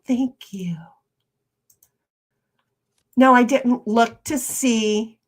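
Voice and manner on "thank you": whispered thanks. Real, not acted. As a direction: breathe the thanks under your voice.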